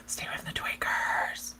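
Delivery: hushed, secretive warning; whispered warning. Real, not acted. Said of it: "whispered warning".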